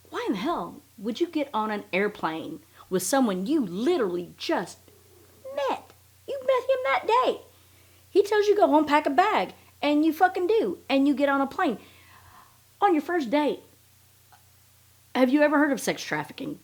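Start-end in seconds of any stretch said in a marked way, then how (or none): 5.5-16.6 higher and louder, baffled disbelief, the end half-whispered; incredulous outburst. Real, not acted. Host ranting at a reckless victim, in high, loud disbelief. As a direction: rant at the listener as if they'd lost their mind. Incredulous rant about flying off.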